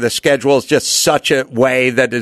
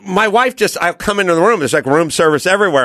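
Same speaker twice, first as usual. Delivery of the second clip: normal talking voice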